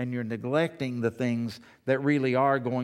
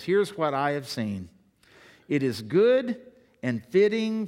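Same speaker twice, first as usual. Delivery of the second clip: preached scripture reading; 5 dB louder, slower than usual. Acted. Reads scripture with force.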